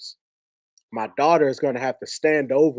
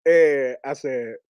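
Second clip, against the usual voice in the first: usual speaking voice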